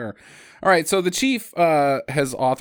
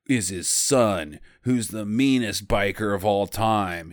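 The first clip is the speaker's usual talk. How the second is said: a hint of a gruff bartender, low and dragged out; low-voiced bartender; 6 semitones lower than usual. Bartender warning the cop about the villain, low and slow. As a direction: mumble it low like a tired barkeep. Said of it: "That's how the bartender said it".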